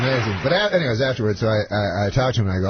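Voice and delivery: ordinary talk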